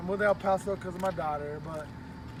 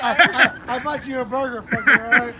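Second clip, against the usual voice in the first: laughing through the words, louder; 9 dB louder than usual. Real, not acted. Laughing as he tells the guy he bought him a burger. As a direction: tease him while laughing.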